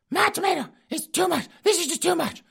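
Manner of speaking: put-on high squeaky old lady griping; falsetto wife complaining. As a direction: whine it in a granny falsetto.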